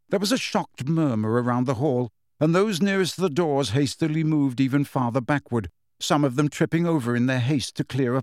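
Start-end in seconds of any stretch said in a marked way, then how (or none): none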